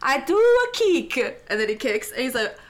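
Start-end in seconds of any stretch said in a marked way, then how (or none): 0.0-1.4 put-on slow, lightly Italian-accented line. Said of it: "in Italian accent".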